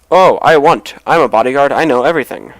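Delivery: lightly acted loud, boastful guard; eager bodyguard. Game player voicing a bribable bodyguard, loud and eager. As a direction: speak up like a guard keen on a bribe.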